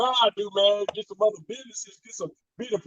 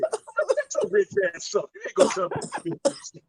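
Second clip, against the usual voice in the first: mocking retort; 9 semitones higher than usual. Real, not acted. Mocking rant at rapping athlete.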